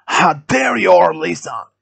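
loud, high angry outburst; screamed outrage. A narrator's reading. Gunman turning on Lisa, screaming. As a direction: shout the accusation, furious. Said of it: "he screamed".

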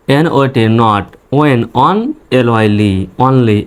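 slow spelled-out teaching read; syllable by syllable. Shown as a demonstration. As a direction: spell N O T, then say অনলি in separate chunks. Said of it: "এভাবে ভেঙে ভেঙে উচ্চারণ করবেন".

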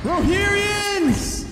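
long drawn-out high rallying shout; rally the riders of Rohan, stretched way out; battle cry. Acted. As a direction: epic mock war cry, very high and slow. Rallying cry after Rohan theme.

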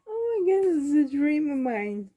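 high, near-tearful delight; overcome with joy. Real, not acted. Receiving a dream gift, overcome with joy. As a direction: start high, as if about to cry happily.

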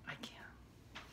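defeated breathy whisper. Real, not acted. Mom overwhelmed by the messy bedroom, whisper. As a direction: whisper it like you've given up.

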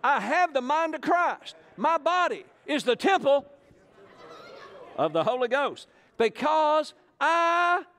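a high, slow, wavering squeak of the timid confession; sing-song the creed like a shy child, high and drawn out; lightly mocking small voice. Preacher leads the crowd in a high mocking sing-song. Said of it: "Say this with me".

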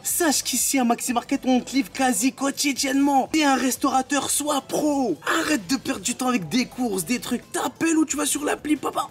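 ton de pub aigu et vendeur; lecture de pub. Acted. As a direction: vends ton service comme une pub radio. Pub improvisée Maxi Market. (voix de pub enjouée)

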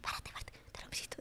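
imitating the producer's urgent whisper. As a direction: hiss it under your breath. Producer prompting her mid-interview.

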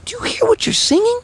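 high, incredulous dad voice; mock dad scolding. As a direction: squeak it out like a baffled parent. Dad scolding daughters about lyrics.

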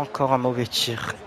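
ordinary talk

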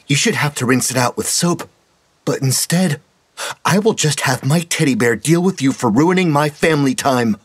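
put-on high, sweet menacing child voice; a spoiled child threatening calmly; creepy little girl. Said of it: "the little girl berated".

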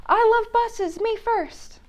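high, loud greedy little penguin; pushy penguin. Acted. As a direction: barge in demanding to go first. Pushy penguin voiced in story.

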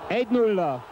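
high excited shout of the score; excited goal call. Real, not acted. Goal call in match commentary.

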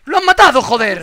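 loud high furious yell; angry shout. Real, not acted. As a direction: curse it out loud. Teammate just got killed.

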